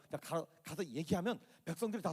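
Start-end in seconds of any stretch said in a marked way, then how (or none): none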